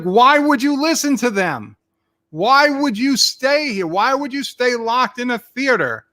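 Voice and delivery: high, exasperated ranting; retold angry outburst. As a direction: demand answers in disbelief. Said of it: "I was so angry".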